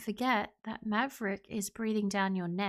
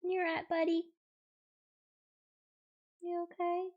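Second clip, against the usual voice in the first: pet talk; 6 semitones higher than usual. Real, not acted. Owner asking buddy if he's okay, pet talk. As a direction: check on a pet in a high, gentle voice.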